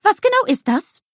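high, loud eager snowman voice; bubbly cartoon snowman exclaiming; Olaf calling out. A narrator's reading.

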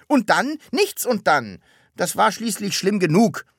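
high, loud mocking echo, then indignant; exasperated call. A narrator's reading. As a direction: call it out, throwing the question back. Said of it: "rief Herr Taschenbier". (aufgebracht gerufen)

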